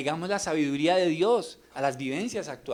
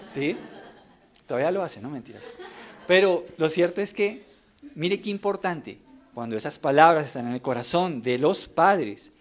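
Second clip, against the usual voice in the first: normal talking voice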